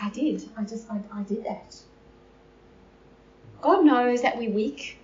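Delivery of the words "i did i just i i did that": much softer, crushed confession; a hushed, broken admission; broken Peter. Retold or imitated. Peter confesses to Jesus, hushed and broken. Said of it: "he burst into tears".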